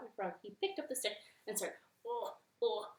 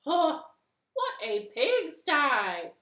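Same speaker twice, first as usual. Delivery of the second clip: loud, slow disgusted exclamation; disgusted frog; 6 semitones higher, 10 dB louder, slower than usual. Acted. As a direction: scold the mess loudly.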